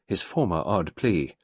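ordinary talk, nothing marked

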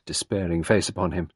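ordinary delivery

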